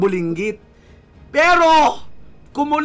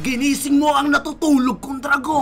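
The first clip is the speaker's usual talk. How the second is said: mock rant; 2 semitones higher, 3 dB louder than usual. Gamer ranting at the kid opponent, hammed-up scolding. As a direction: play the furious parent berating a kid.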